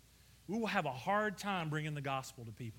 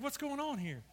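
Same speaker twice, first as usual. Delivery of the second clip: pitched up, baffled question; a hint of the older brother's confusion; puzzled older brother; 8 semitones higher than usual. Preacher voicing the brother at the party, baffled. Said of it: "He's like".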